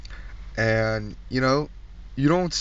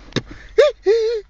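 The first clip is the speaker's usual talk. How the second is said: high loud jeering laugh; mocking cackle; 7 dB louder than usual. Comic laughs at the sentenced man, high and loud. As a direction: cackle at him.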